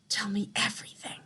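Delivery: quick urgent whisper. A narrator's reading. Grandmother whispers to the shaken boy, quick and urgent. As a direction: hush it out quickly.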